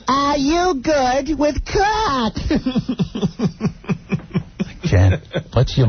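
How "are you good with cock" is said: high, slow old-lady voice mocking the mother-in-law. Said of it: "she goes".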